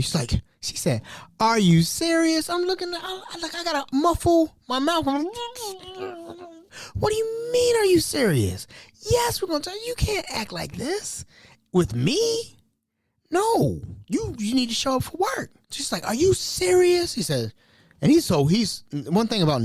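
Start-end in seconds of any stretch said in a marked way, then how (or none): 1.4-2.5 high, slow imitation of her disbelief; mock disbelief. Fired worker reacts, imitated. 6.9-15.5 high-pitched incredulous comeback; exasperated outburst. Retold or imitated. His muffled outburst at her question. 16.1-17.1 much higher, slow incredulous imitation; mock disbelief. Fired worker reacts, imitated.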